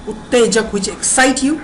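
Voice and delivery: ordinary talk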